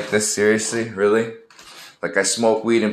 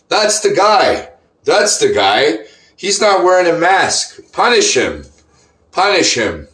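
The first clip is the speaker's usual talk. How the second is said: mock yelling customer; 10 semitones higher, 4 dB louder than usual. Customer pointing at him, yelling to the manager, mocking. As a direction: ham up a tattling customer shouting at the manager.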